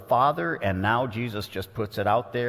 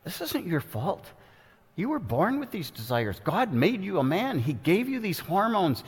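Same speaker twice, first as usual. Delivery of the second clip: devil's voice; 7 semitones higher than usual. Acted. The devil telling tempted men it isn't their fault, high coaxing voice. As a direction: lull him with a reassuring lie. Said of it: "the devil tells them".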